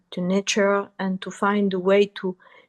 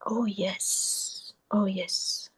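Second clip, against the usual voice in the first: soft, hushed self-quote; quiet realisation; 9 dB quieter, much slower than usual. Retold or imitated. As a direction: say it softly, as if to yourself. Quoting own relieved inner realisation.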